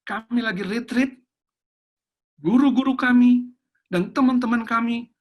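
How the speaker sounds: put-on high, rising retelling of a child's call; child's urgent phone call. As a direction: imitate a child urgently reporting to Papa. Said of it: "tiba-tiba anak saya telepon saya".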